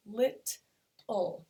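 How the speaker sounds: "lit", pause, then "ul"; split into syllables. Shown as a demonstration. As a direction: sound out lit and ul as separate beats. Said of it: "we can do the same thing with".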